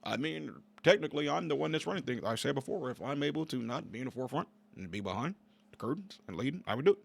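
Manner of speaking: halting, hushed impression of a guy in charge; mimicked behind-the-scenes politician. Vlogger mimicking the man running the show, halting hushed impression. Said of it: "there's a guy that talks just like this".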